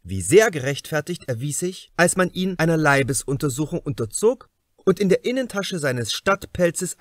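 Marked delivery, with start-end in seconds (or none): none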